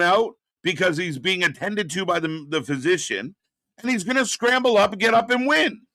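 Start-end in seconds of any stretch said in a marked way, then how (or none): 3.8-5.8 rising, hyped call; excited. Real, not acted. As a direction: build it up like a comeback call. Sarcastic call of the comeback.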